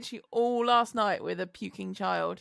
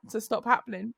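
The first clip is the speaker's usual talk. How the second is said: ordinary talk, nothing marked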